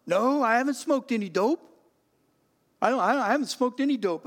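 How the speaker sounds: put-on high, loud, protesting teen; defiant denial. Teenage son denying it to his father, loud and defensive. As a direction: deny it hotly, tripping over words. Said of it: "And I go".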